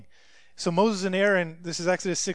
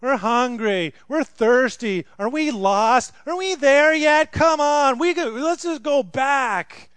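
put-on louder, higher whining like kids in the back seat; whiny kids on a road trip; 5 semitones higher, 7 dB louder, slower than usual. Israelites as kids on a family road trip, loud high whine. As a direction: nag like bored children asking are we there yet. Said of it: "the biblical version of a, a family road trip".

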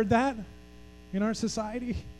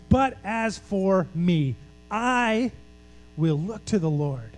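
"But as for me" drawn out slowly, "I" set apart and pitched up; emphatic declaration; slower than usual. Acted. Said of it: "it's emphatic here".

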